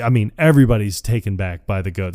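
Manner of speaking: normal talking voice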